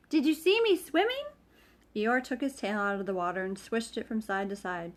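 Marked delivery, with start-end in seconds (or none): 0.1-1.3 high squeaky excited voice; much higher excited squeak. Acted. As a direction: squeak it proudly. Roo boasting.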